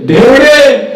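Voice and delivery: దేవుడే shouted high and loud; preacher's climactic shout; shouted climax. Shown as a demonstration. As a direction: hit దేవుడే as a triumphant cry. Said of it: "rising repetition".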